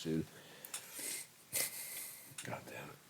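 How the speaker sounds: ordinary delivery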